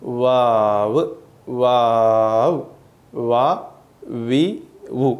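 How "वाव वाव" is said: वाव drawn out very slowly with round lips; rounded-lip waw. Shown as a demonstration. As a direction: stretch वाव long, lips rounded forward. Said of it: "गोल जैसा होठ रखवाने का". (होंठ गोल करके धीरे वाव)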